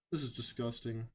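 low grim verdict; disgusted. Real, not acted. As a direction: say it with quiet disgust. Disgusted reaction.

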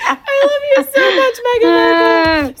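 high, loud, laughing mock wail; fangirl gushing. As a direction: wail your adoration through laughter. Fan gushing while laughing.

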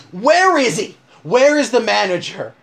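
bursting through the door demanding the manager; furious demand. Retold or imitated. Buddy storming into the store for the manager, furious. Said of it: "rips open the door".

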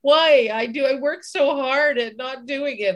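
frustrated rant at yourself; frustrated outburst. Retold or imitated. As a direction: vent like you're fed up with yourself. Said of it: "I feel like".

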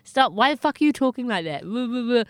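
put-on drunken heckler. Drunken guy confronting squeaky-voiced woman, mock annoyed rant. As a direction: lightly imitate an annoyed drunk. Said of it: "odd drunken guy who's like".

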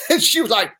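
ordinary talk

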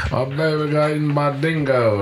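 mock Australian accent. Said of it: "What do they always say?".

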